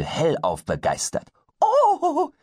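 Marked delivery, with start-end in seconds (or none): 1.6-2.3 very high, loud kobold exclamation; delighted kobold cry. Acted. Pumuckl hears there are deer, very high delighted cry. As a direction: squeal of pure joy as a goblin. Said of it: "rief er".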